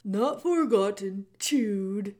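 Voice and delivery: put-on loud, booming old jailer who speaks of himself by name; the jailer Gregory greeting Meg in a big theatrical voice. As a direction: boom it out like a grand old jailer. Gregory explains.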